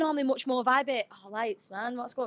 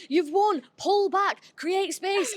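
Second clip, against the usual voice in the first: retold yell; 6 semitones higher, much slower than usual. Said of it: "Kev was screaming".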